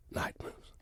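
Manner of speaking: hushed reveal. Acted. Dramatic reveal of the slow jam.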